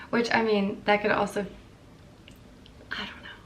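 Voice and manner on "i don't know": hushed, nervous whisper. Real, not acted. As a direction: breathe it out in a scared whisper. Scared, whispering a caveat.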